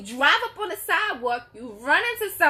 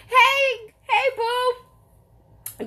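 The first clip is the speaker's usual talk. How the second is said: high, laughing hello; excited greeting; 12 semitones higher, much slower than usual. Real, not acted. Vlogger greeting a viewer by name, laughing and high. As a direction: greet a friend in the chat with glee.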